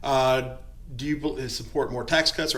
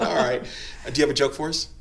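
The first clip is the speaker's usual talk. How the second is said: ordinary talk, nothing marked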